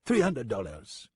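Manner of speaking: put-on slow French-accented verdict; French-accented price quote. French gunman names his fee to the deputy, matter-of-fact French accent. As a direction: state the total in a French accent, falling at the end.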